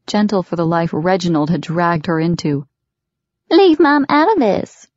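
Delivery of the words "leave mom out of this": high, loud mock of a woman's plea; cruel mocking. A narrator's reading. Father parrots daughter's plea, high cruel mockery. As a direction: mimic her cruelly, far higher and louder. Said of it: "he mocked her cruelly".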